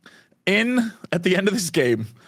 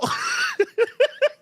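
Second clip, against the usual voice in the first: squealing teary laugh; high laughing wail; high laughing cry. Real, not acted. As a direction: let out a shrieking laugh-sob. Overwhelmed, riding the dragon.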